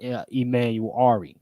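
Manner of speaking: ordinary talk, nothing marked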